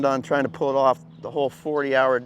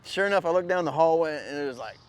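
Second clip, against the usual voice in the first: normal talking voice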